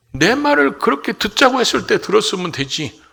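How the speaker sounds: high, loud acted grumble of blame; resentful complaint. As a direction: complain loudly as if nobody listened to you. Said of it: "다 원망 불평하면서".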